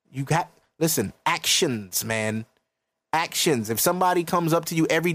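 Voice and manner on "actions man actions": ordinary talk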